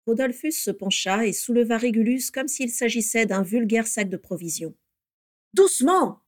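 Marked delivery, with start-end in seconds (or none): 5.5-6.2 high, loud warning; urgent exclamation to be careful; crying out. A narrator's reading. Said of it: "s'écria Bellatrix". (cri d'alerte)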